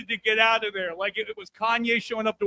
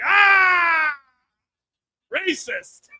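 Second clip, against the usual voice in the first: mock shout; 5 semitones higher, 7 dB louder, much slower than usual. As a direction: blurt it out in a high mock shout. Mock shouted self-accusation.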